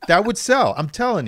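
loud, high, emphatic; excited. Real, not acted. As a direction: insist it would sell. Excited about a book idea.

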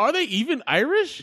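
high-pitched disbelieving question; incredulous. Real, not acted. Incredulous objection in banter.

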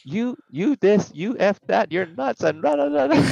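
high, laughing mock yell at the driver. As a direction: yell insults while cracking up, slowed at first. Cop yelling while laughing.

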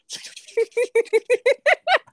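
sudden high laugh; laughing at the absurd memory; high burst of laughter. Real, not acted. Laughing at the stab story.

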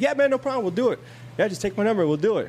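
high eager buddy voice; imitating the trooper. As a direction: play his easy yes, upbeat. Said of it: "He's like".

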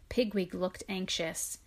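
plain reading voice

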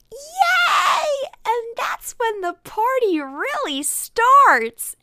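very high, loud party squeal; mock Jackie's over-the-top whoop, drawn out; excited cheer. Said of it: "she's so happy".

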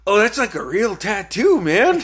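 loud retold astonishment, laugh at the end; surprised realization. Imitates cousin's surprise at the tattoo.

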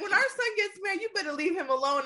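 high, laughing through the quote; giggling as she retells. Real, not acted. Laughing while quoting husband.

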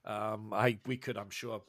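low, soft, halting gentleman scholar; hesitant professor, politely deferring. Acted. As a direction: stammer apologetically, keeping it low and soft. Professor answers in character.

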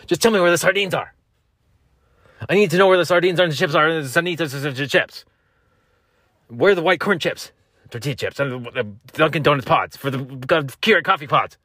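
high, loud mock rant; hammed-up angry demands; angry delivery. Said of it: "start talking like that for real".

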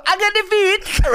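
very high, loud put-on squawk; mock gang member. Comic answering fans with his one line, squawky gangster voice. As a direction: squeal the catchphrase like a comic gangster. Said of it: "I go".

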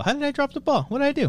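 high, mock-offended squeal; mock hurt. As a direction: play wounded as a joke. Mock-wounded after chat comment.

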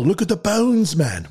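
high-pitched mock outcry; sudden jump up high, hammed-up; mock alarmed cry. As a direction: cry out high, as a movie parody. Mock cry quoting a film reference.

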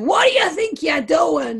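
lightly imitated Italian accent; angry dad in an Italian accent. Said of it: "in Italian accent".

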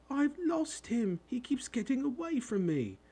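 very high-pitched lament; wailing. A narrator's reading. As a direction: wail it like a distraught boy. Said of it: "he wailed".

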